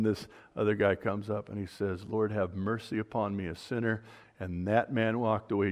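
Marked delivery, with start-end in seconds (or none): 2.0-4.0 humble plea. Retold or imitated. Humble sinner prays for mercy.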